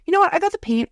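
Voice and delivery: high, bright sudden idea; a hammed-up eureka; mock eager self. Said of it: "And I'm like".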